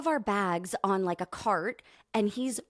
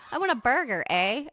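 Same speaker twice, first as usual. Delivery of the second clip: mock Canadian; 4 semitones higher, faster than usual. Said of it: "Pretend like you're Canadian".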